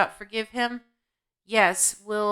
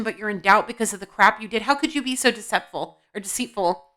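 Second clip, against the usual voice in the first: ordinary talk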